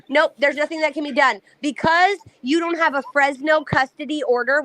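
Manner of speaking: normal talking voice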